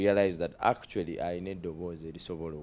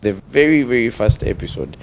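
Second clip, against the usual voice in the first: emphatic; 3 semitones higher, 12 dB louder than usual. Real, not acted. Emphatic shout out to viewers.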